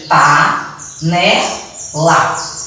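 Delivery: pa, né, lá as three distinct beats; a short pause after each of pa, né, lá; syllable-by-syllable dictation of pa, né, lá; separated syllables. Shown as a demonstration. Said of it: "Eu separei as sílabas".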